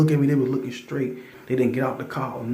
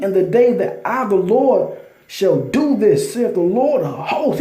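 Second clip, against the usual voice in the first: louder, slowed, preaching cadence; fervent scripture reading; 6 semitones higher, 4 dB louder than usual. Acted. Reading Malachi aloud to his listeners, fervent loud preaching. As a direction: proclaim the verses like a sermon.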